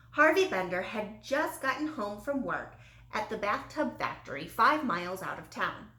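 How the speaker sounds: plain reading voice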